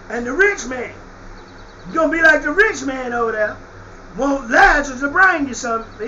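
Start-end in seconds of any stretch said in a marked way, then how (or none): none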